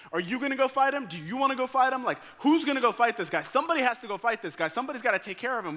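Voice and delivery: rally them, voice climbing and rushing; worked-up urging. Retold or imitated. David urging the frozen army to fight, excited and loud.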